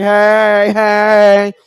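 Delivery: high, loud, sassy "hey, hey"; sassy greeting. Retold or imitated. Comic mimics his own sassy greeting from Atlanta. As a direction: toss out a sassy double hey. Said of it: "I came back with a little sass".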